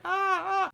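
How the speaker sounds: very high mock screams; short high-pitched yelps imitating the victim; imitated yelling. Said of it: "Motherfucker yelling".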